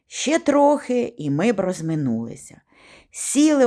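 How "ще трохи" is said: usual speaking voice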